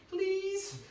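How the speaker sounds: normal talking voice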